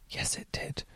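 barely audible whispered admission; confessional aside. Acted. Answering own question about skipped exercise, hushed confession. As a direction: confess it under your breath.

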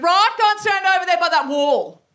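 high, loud teacher's command; Miss Power. Acted. Teacher sending chosen girls to the wall, commanding. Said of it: "And then she went".

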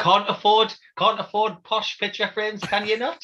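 a hint of a chirpy kids' presenter; imitating TV presenter. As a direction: do the friendly craft show host. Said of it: "his introduction to that".

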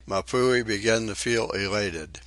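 plain reading voice

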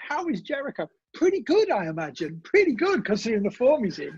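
high, sing-song asking and answering; playful high reply; mock question and answer. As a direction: pretend someone is asking after him. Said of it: "invites a question mark".

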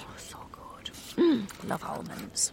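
whispered with a mouthful; soft whispered pleasure over food; savoring delicious food. Real, not acted. Eating the pudding.

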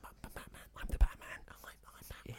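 hushed whispered Batman; whispery Batman. Pretend. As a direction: breathe it out as Batman. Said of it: "a whispery Batman".

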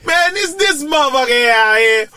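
high, loud comic heckle; mock roast. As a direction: roast someone in front of the whole room. Acts out comedians roasting him.

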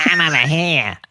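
high, exaggerated Australian female voice; Australian woman assistant. Phone answers its lost owner, mock Australian woman. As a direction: put on a broad Australian woman's voice. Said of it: "mine's got the Australian women's, uh, voice".

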